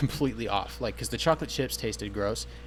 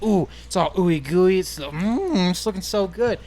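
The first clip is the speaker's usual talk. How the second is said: slow, high and louder, relishing every word; mock rapture; 7 semitones higher, 5 dB louder, slower than usual. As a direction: swoon over the pastry. Retelling admiring the rolls.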